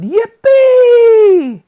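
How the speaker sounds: very loud, high whoop; explosive yell. Acted. Grandpa Joe leaping out of bed, very loud high whoop. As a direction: explode with joy.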